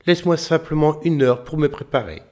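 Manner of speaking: high eager voice; sly little tailor. A narrator's reading. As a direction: pitch it up, cheeky. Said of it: "dit le petit tailleur".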